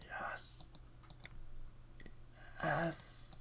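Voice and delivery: very soft, whispered swoon. Acted. Reacting to hearing a British girl, swooning whisper. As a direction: whisper it as if smitten. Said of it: "Every time I hear a British girl".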